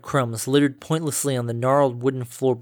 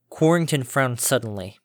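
usual speaking voice

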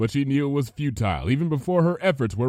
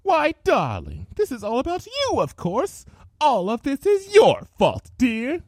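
cruel gloating villain; 13 semitones higher, 5 dB louder than usual. Acted. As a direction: sneer the blame at her.

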